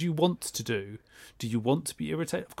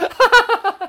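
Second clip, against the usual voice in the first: loud, high-pitched laugh; burst of laughter; 14 dB louder than usual. Real, not acted. Laughs at own silly sign-off.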